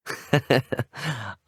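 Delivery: brief, easy laugh; light chuckle. Acted. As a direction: chuckle lightly before replying. Chuckle before agreeing to enjoy victory.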